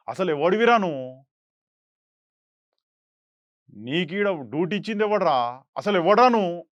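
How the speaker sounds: loud, challenging film dialogue; demonstrated movie-style face-off; acted confrontation. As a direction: challenge an officer, punching the questions. Acts film confrontation with a policeman.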